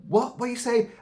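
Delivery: high, startled questions; baffled and frightened; confused alarm. Retold or imitated. She asks Paolo what he means, high and alarmed. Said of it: "she's going".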